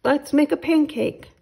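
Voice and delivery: put-on eager boy. Jack eager for pancake.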